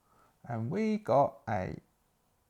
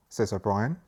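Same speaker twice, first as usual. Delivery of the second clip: ordinary talk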